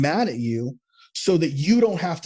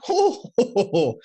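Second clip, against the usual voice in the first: hoho laugh; 8 semitones higher, slower than usual. Real, not acted. Laughs at his own pun.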